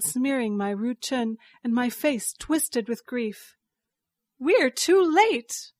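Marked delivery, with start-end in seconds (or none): 4.4-5.7 high, loud, drawn-out cry; alarmed cry. A narrator's reading. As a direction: cry out in alarm, slow and raised. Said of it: "Meng Xiaochen cried".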